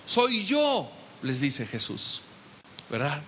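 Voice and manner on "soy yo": mock call out. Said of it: "les grita".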